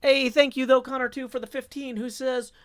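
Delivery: ordinary talk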